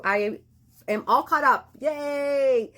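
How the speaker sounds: happy cheer. Real, not acted. Celebrating catching up on readings.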